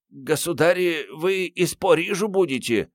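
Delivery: put-on timid old servant, pitched high; робкий старый слуга. A narrator's reading. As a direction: venture the question meekly in a high voice. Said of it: "осмелился заикнуться ключник". (робко, высоким стариковским голоском)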